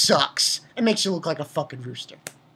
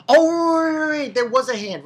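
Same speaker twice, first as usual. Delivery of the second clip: sudden realization; 9 semitones higher, 5 dB louder than usual. Real, not acted. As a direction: blurt it as the memory hits. Sudden recollection of the hand.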